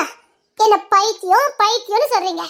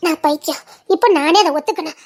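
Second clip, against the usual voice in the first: ordinary delivery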